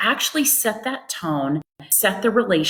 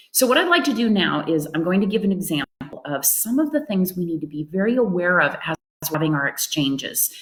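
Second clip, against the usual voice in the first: ordinary delivery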